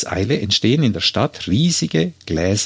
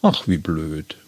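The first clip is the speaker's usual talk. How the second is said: bedauernd; 7 semitones lower, slower than usual. A narrator's reading. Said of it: "Sie mit bedauerlicher Stimme".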